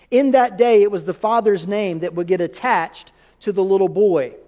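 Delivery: normal talking voice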